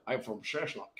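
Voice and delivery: quiet mock Scottish film-star accent; Sean Connery. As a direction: toss off a soft, hammed-up Connery accent. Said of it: "a Sean Connery accent".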